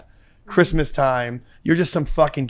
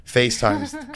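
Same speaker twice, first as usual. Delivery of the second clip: ordinary talk, nothing marked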